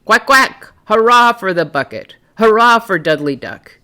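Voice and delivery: loud, high duck call; big voice. Acted. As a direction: call out to all the ducks in triumph. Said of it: "He called in a big voice".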